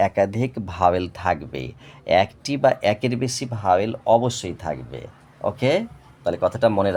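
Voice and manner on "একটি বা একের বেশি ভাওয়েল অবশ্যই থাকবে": ordinary talk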